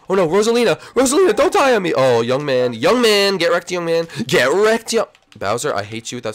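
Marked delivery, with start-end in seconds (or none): 0.1-1.9 high, loud, fast panicked plea; panicked cry. Real, not acted. His character about to die. 1.9-5.1 loud gleeful taunt; gloating shout. Real, not acted. Gloating over opponent in game.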